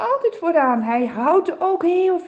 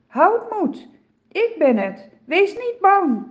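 calling over the storm; 6 semitones higher, 5 dB louder than usual. A narrator's reading. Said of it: "klinkt het door het lawaai".

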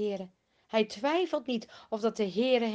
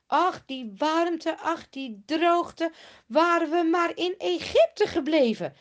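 higher, louder, slow whiny moaning; grumbling complaint; 4 semitones higher, 6 dB louder than usual. Acted. Israelites grumbling to Mozes, slow and whiny. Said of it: "Ze beginnen ook lelijk te mopperen".

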